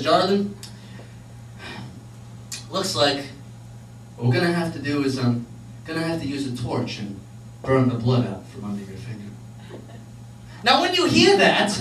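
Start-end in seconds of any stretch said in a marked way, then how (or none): 0.0-9.3 low, quiet, dead-serious doctor; grave doctor. Retold or imitated. Doctor grimly tells Jonathan he needs a torch, low and quiet. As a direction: deliver bad news with a straight face. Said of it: "he looks at me with all seriousness".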